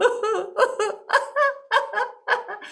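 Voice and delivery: a very high, quavering laugh-cry wail; hammed-up weepy howl; high wailing sob. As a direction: let out a comic boo-hoo wail. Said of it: "wailing and crying louder than ever".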